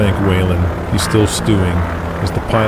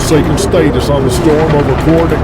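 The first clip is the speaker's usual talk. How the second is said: louder, urgent radio call; urgent mayday call; 7 semitones higher, 4 dB louder than usual. Acted. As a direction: call for help, pressed. Pilot radio distress.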